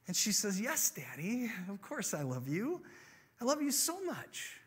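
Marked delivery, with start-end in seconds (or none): 0.6-2.8 put-on lilting, affectionate child's reply; happy little girl. Five-year-old answers her dad at bedtime, happily. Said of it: "this time, she's not smiling". 3.4-4.6 put-on high, lilting child's affection; adoring little girl. Five-year-old tells her dad, adoringly.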